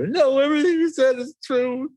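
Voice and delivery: high, weepy mock sob; imitating crying. Road rager agreeing while crying, high mock sob. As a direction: blubber it out, half comic. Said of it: "the guy starts crying".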